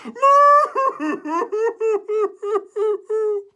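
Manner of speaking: high shrieking laugh; high hammed-up laughter; cow laugh. Cow lying on ground laughing.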